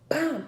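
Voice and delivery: imitated door slam. Mom imitating daughter slamming door, loud. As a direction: slam the word like a car door.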